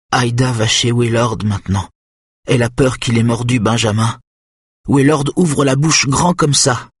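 put-on a child gravely warning. A narrator's reading. Nick solemnly warns Kenneth about Willard, earnest. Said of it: "d'un ton grave".

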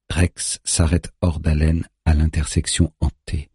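ordinary delivery

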